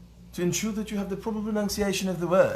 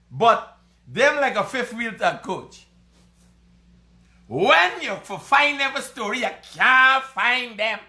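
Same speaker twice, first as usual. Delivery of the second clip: high, loud Guyanese Creole character voice; rowdy mock radio announcer; put-on announcer accent; 8 semitones higher, 6 dB louder than usual. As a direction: crow it out in a high, cackling put-on voice. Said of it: "y'all stop with this accent".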